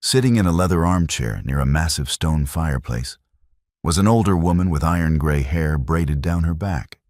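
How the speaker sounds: usual speaking voice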